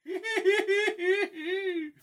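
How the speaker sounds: shrieking high cackle; hyena laugh. Acted. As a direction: let out a wild hyena laugh. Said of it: "with a hyena laugh".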